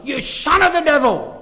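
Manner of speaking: loud, forceful "You son of the devil"; strong denunciation. Retold or imitated. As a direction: thunder it out like Paul condemning. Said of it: "Very strong language".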